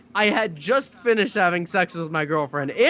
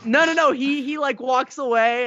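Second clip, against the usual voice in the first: ordinary talk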